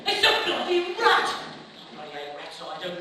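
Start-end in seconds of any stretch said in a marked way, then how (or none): 0.1-1.3 loud, high cry of disgust; startled shout. Acted. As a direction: yell out at the rat. Soldier spots a rat.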